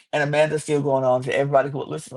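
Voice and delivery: ordinary talk, nothing marked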